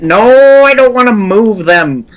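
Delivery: high, loud alarmed cry; panicked shout. Real, not acted. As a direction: yelp it out in alarm. Panicked at file move.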